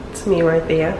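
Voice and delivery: ordinary talk, nothing marked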